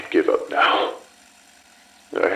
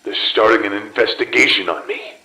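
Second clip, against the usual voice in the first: ordinary talk, nothing marked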